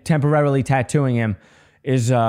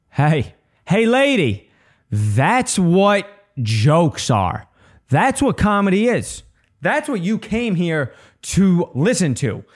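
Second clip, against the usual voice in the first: high-pitched, stretched-out indignant rebuke; exasperated retort; 6 semitones higher, slower than usual. Retold or imitated. Comic to heckling lady, exasperated retort.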